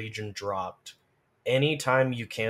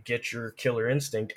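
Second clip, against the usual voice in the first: usual speaking voice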